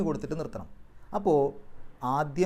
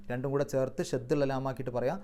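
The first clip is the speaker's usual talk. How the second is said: ordinary delivery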